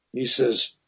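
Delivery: ordinary delivery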